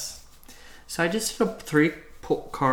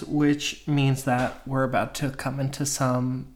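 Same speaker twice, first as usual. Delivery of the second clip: ordinary talk, nothing marked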